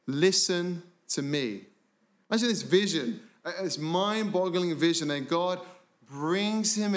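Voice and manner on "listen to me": slow command. Acted. God's voice commanding the prophet.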